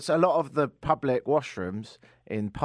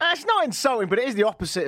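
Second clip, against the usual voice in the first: surprised protest; 9 semitones higher, 4 dB louder than usual. Real, not acted. Correcting a misunderstood British word.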